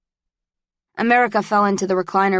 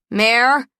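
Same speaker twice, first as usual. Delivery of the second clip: louder, higher call to another room; 4 semitones higher, 5 dB louder than usual. A narrator's reading. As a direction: call her name from the bedroom. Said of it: "Shepley called from the bedroom".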